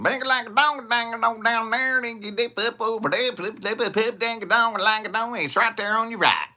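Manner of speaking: high, loud hillbilly directions gabble. Retold or imitated. Local giving directions, in a high mock hillbilly twang. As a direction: rattle off directions like a thick-accented local. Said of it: "country twang to my accent".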